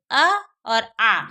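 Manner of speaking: अ said short, then आ drawn out long. Shown as a demonstration. Teacher showing students short अ then long आ. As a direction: contrast a quick अ with a stretched आ. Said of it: "देखो अब मैं उच्चारण कर रही हूँ".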